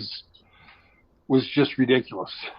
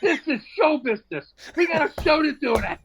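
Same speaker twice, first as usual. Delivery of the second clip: high pitched, laughing, pompous showbiz voice; mock indignant performer; 12 semitones higher than usual. Comic scolding the diners that he has a show tonight. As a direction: play the self-important entertainer. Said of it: "He said this".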